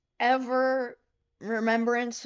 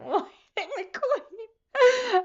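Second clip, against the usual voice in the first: squeaky, much higher laugh-cry on McQueen; 13 semitones higher, 4 dB louder, much slower than usual. Real, not acted. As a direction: laugh until it cracks into tears. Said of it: "went straight to crying".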